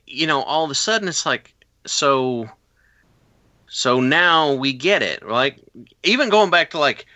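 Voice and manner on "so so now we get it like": normal talking voice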